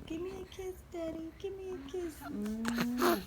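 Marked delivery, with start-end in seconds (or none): 0.1-2.1 put-on high, soft baby voice; voicing the baby. Mother voicing the baby asking daddy for a kiss, high and soft. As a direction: coax a kiss in a tiny voice.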